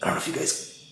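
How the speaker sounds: breathy whisper. Real, not acted. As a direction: start it in a whisper. Whispering, then repeats in normal voice.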